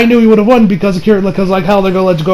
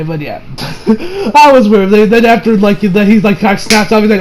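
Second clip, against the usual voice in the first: normal talking voice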